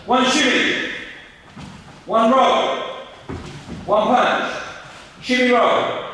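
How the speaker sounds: high, projected coach's call; calling out drill commands. Real, not acted. Coach drilling pupils in shimmies and rolls, calling out. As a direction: punch out each command for the group.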